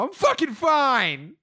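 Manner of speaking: high, loud, drawn-out mock yell; hurt kid snapping. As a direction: imitate an exasperated kid insisting. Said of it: "They go".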